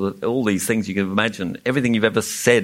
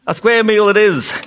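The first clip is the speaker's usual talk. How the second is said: raised, high story-voice shout; 10 semitones higher, 6 dB louder than usual. A narrator's reading. Humbug seizing on Milo's suggestion, shouted. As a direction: call it out loud and high. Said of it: "shouted the humbug again".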